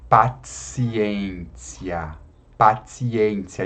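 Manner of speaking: "Paciência" drawn out as "pa-tsi-en-tsi-a". Shown as a demonstration. Teacher pointing at the word, "Paciência" with TS. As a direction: slowly sound TS in "Paciência". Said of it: "como se a gente colocasse um S".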